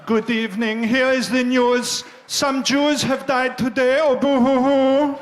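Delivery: hammed-up German accent reading the news, boo hoo; mock German newsreader. Comic reading the news as a sad German, loud and hammed-up. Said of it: "sound like a sad German voice".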